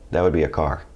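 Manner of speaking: ordinary talk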